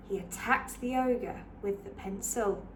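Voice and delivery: put-on lively read-aloud for children; story narration. A narrator's reading. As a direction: read it like a bedtime picture book. Picture book read aloud.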